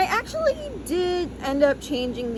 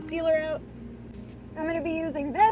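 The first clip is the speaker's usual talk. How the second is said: muffled through a respirator mask; talking through a full face mask; 6 semitones higher, 4 dB quieter than usual. Real, not acted. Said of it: "Sorry if I sound funny. I have the respirator on".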